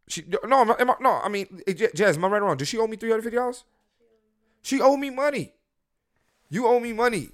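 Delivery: high, loud, incredulous protest; indignant. Real, not acted. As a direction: squeal it, genuinely outraged. Appealing to Jazz, indignant.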